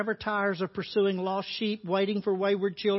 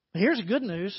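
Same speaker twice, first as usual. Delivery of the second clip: ordinary talk, nothing marked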